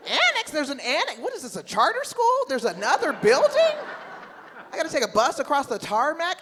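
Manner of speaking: high, loud disbelief with a laugh; can't believe there's an annex; incredulous. Acted. Comic at the airport, shocked there's an annex, high and loud.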